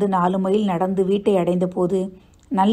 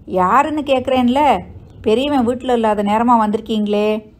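raised, higher voice challenging a visitor; demanding call; 4 semitones higher, 4 dB louder than usual. Acted. Mother challenging an unknown night visitor, demanding call. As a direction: call out sharply, wanting an answer.

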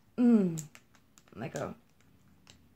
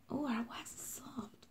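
whisper; 3 semitones higher, 18 dB quieter than usual. Real, not acted. Touching the soft wax.

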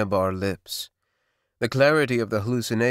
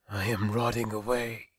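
soft, drained murmur to himself; barely voiced despair; hushed inner thought; 6 dB quieter than usual. A narrator's reading. Feverish youth thinks he is dying, softly.

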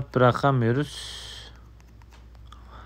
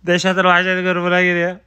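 loud, higher mock TV greeting; hammed-up shout-out to the relatives; old TV shout-out voice; 5 semitones higher, 6 dB louder than usual. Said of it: "eskiden televizyonda olurdu ya".

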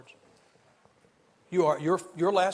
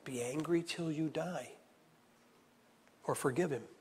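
whispered warning; 8 dB quieter than usual. Acted. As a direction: breathe the warning almost silently.

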